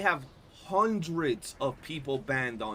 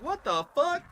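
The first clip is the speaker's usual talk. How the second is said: high, outraged what the fuck; mock outrage, pitched far up; retold disbelief; 3 dB louder than usual. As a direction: squeal it up in shocked disbelief. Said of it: "And I'm like".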